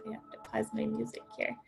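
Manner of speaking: quick, near-whispered aside; hushed aside. Real, not acted. Co-host pausing her music mid-introduction, hushed aside. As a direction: drop to a soft, hurried murmur.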